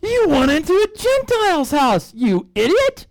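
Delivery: high, slow, mock-outraged scolding. Jerusalem elders scold Peter, high mock outrage. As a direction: play the elders chewing him out. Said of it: "the guys call him on the carpet".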